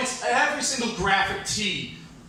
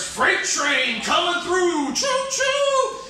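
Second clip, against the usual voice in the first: high, slow holler rising to a hooted whistle; hollered train slogan; 11 semitones higher, 4 dB louder, much slower than usual. Acted. Voicing a freight train T-shirt, whooping holler.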